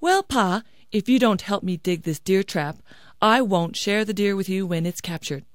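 ordinary delivery

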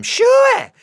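high, loud shooing holler; hollering at goats. Acted. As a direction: shoo the goats off with a big high yell. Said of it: "I hollered at them".

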